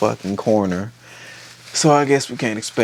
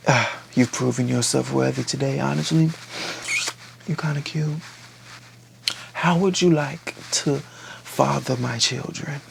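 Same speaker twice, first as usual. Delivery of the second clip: lightly voiced seductress, higher and soft; coy proposal; 3 semitones higher, 5 dB quieter than usual. Woman proposing to the man who won her respect, coyly. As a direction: coo it a little, higher, with lingering pauses.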